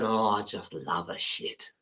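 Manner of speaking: low, soft, slow blissful voice; dreamy Finnish guy. Pretend. Finnish guy tells British guy his love, low soft slow relish. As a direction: savour every word like a happy man. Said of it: "Finnish guy goes".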